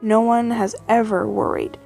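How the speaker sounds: ordinary delivery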